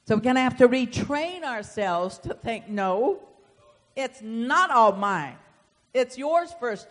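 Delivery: loud, emphatic pulpit delivery; forceful preaching. Real, not acted. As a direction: preach it hard to the congregation.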